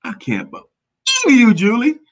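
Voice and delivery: teasing mock outrage at Julie; husband's catchphrase. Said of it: "That's how he said it".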